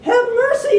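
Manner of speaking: very high, loud pleading; imploring. A narrator's reading. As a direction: beg the big lion for mercy. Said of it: "implored the king".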